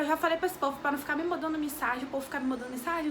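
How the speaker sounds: ordinary talk, nothing marked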